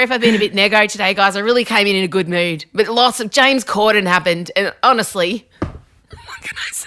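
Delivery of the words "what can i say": slow, hushed whisper. Real, not acted. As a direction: whisper it as a helpless aside. Whispered aside after apology.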